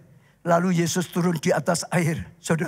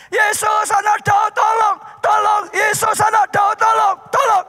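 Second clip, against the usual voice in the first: very high, loud pleading shout; shouting even louder; 6 dB louder than usual. Acted. Blind man shouting louder to Jesus, desperate and very loud. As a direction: scream it over everyone, begging. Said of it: "Dia teriak lebih keras lagi".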